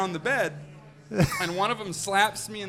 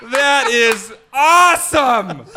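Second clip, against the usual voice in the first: loud, slow and laughing; laughing delight; 7 semitones higher, 11 dB louder, much slower than usual. Retold or imitated. Groom cheering the raid he thinks is fake, laughing loudly. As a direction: gush with giddy joy.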